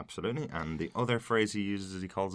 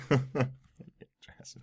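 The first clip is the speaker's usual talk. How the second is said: laughing; 8 semitones higher, 5 dB louder than usual. Real, not acted. Laughs at co-host's word.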